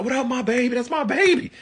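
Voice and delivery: very high, delighted greeting; long-lost friend greeting. Shown as a demonstration. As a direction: greet someone you ain't seen in a while, voice way up. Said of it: "if it's somebody you ain't seen in a while".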